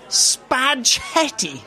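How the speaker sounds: diner misreads spaghetti off the specials board, sounded out wrongly; mispronounced. Acted. Diner asks waiter about specials, mispronouncing spaghetti. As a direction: spaghetti with a hard, mistaken middle, like "spad-chetti". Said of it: "That's how you pronounce it".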